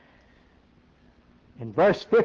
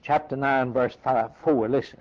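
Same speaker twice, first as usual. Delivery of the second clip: ordinary talk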